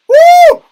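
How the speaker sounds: loud, very high "woo"; excited whoop. Real, not acted. Fan cheering the replayed goal, excited whoop. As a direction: let out a piercing celebratory whoop.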